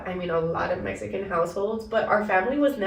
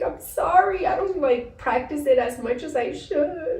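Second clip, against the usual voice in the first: mock whiny apology; 7 semitones higher than usual. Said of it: "And it's just like".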